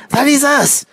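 high, squealing monkey voice; excited little monkey piping up; excited cry. A narrator's reading. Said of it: "cried the monkey".

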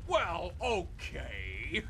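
ordinary talk

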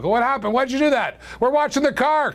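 high-pitched, playful protest; mock scolding. Host to his producer over the camera view, mock scolding.

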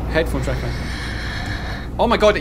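usual speaking voice

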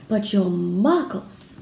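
slow, drawn-out delight. A narrator's reading. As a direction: marvel at them, stretching the words.

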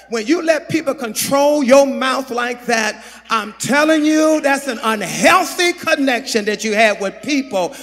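high, loud, driving preacher's delivery; full-force preaching. Real, not acted. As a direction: hammer each repeated phrase at the congregation.

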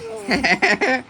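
high, bright burst of laughter. Real, not acted. Owner laughing at her pet's pose, high laugh.

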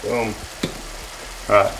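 usual speaking voice

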